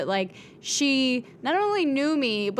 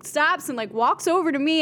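ordinary talk, nothing marked

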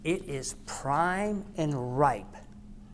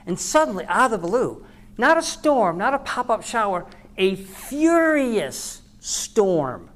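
loud, higher, driving sermon build; preaching at full force; 6 semitones higher, 10 dB louder than usual. Acted. Pastor preaching the storm story, loud and rising. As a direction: preach it out big to the room.

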